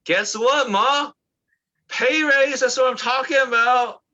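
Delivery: high, loud celebratory shout; excited happy news. Acted. Telling mom about a pay raise, excited shout. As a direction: shout it out like you just won big. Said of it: "we'll share happy news".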